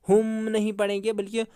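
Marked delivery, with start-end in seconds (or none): none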